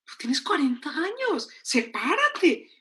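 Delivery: exasperated urging. Retold or imitated. Psychologist to her crying sister, exasperated urging. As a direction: urge her, stretching the last word.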